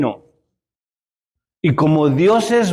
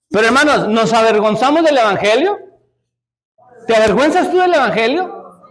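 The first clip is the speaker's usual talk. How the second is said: preaching challenge; 5 semitones higher than usual. Real, not acted.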